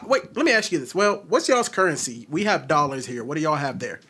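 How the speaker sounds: ordinary talk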